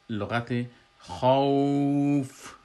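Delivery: تلفظ کشیده خوف; stretched madd. Shown as a demonstration. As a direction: lengthen the vowel of خوف deliberately. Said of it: "در آیت آخر".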